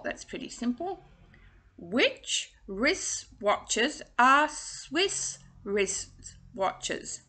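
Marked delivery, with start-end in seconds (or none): 1.8-7.2 very slow, drawn out; slow tongue twister. Shown as a demonstration. Coach reading the twister slowly before the fast try. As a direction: take it word by word. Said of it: "say them again for you".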